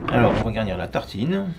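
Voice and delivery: ordinary talk, nothing marked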